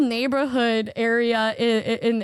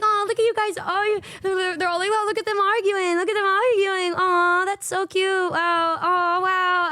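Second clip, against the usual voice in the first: mock cooing friends; 4 semitones higher than usual. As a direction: coo like teasing onlookers. Mocking friends cooing at them.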